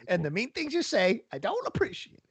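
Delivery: put-on prim, prissy old lady, pitched high; a hurt granny scolding gently. A cohost voicing an offended old lady, hammed up. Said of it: "you can't make old lady voices".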